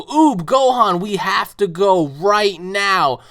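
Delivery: high, loud, slow urgent shout. Retold or imitated. Goku shouting to Oob and Gohan to leave, high loud slow urgent shout. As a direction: yell it out, no time to lose.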